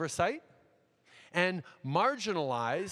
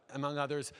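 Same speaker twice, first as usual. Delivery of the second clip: usual speaking voice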